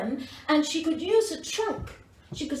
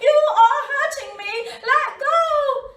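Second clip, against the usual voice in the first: much higher and louder, frightened pleading; panicked cry; 10 semitones higher, 6 dB louder than usual. A narrator's reading. Baby elephant begging the crocodile to let go, high panicked shout. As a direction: yelp to be let go. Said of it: "the baby elephant went".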